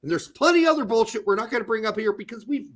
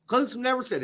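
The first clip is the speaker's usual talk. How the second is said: ordinary delivery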